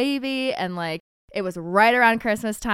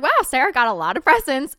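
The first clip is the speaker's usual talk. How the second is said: a little snarky, pointed and louder; 5 semitones higher, 5 dB louder than usual. Retold or imitated. Daughter needling her mom about the gifts, snarky. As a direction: retell your own sarcastic jab at the presents. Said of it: "it was a little snarky".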